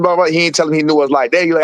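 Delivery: ordinary talk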